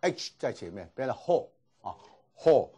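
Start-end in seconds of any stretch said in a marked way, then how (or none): 1.2-2.7 HO with a breathy H in front of the vowel; added H sound. Shown as a demonstration. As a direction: say "ho" with a clear initial H. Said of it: "等于是多了个子音". (ho前面加H音)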